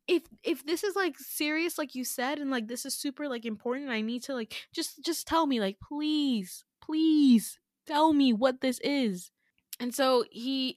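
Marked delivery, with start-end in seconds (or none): 0.1-9.3 higher, halting retold pleading; desperate plea. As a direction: act out begging, stretching the final please. Begging him to explain.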